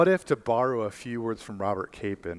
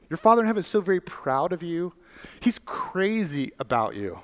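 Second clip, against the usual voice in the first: high, ringing preacher's climax; preaching at full force; 4 semitones higher than usual. Acted. Preacher tells his congregation God brags about them, high and ringing. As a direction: lift the room, proclaim it.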